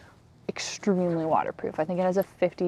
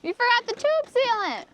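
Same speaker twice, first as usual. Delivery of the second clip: surprised. Real, not acted. Partner forgot the sealant.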